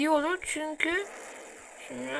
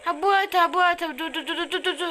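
high, loud urgent stop; excited stop; 4 semitones higher, 5 dB louder, faster than usual. Real, not acted. Gamer stopping viewers to show the map, urgent. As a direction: halt everyone, excited.

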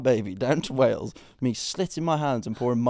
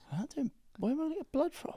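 squeaky high voice, fast; high-pitched protest; 8 dB quieter, faster than usual. Real, not acted.

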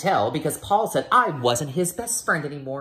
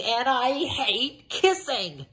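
grossed out; 11 semitones higher, slower than usual. Acted. Child grossed out by TV kissing.

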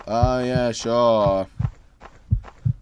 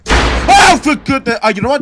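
fed-up outburst; 11 semitones higher, 10 dB louder than usual. Real, not acted. Frustrated, giving up.

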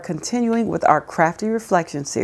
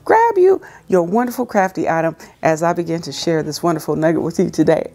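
ordinary talk, nothing marked

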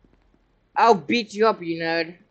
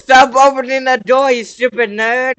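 loud, high furious yell; angry shout; 5 semitones higher, 8 dB louder than usual. Real, not acted. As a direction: yell at him to stop. Yelling at player opening door.

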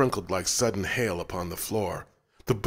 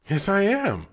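put-on high insistent child; 12 semitones higher, 5 dB louder than usual. A narrator's reading. Boy insists on identity.

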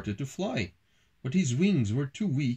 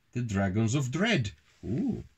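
ordinary delivery, nothing marked

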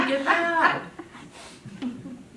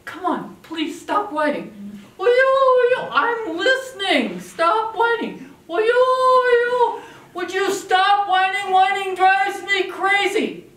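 rising higher and louder, almost sung in frustration; exasperated parent; 11 semitones higher, 7 dB louder, slower than usual. Shown as a demonstration. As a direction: build from pleading to fed-up wail. Parent fed up with a whining child.

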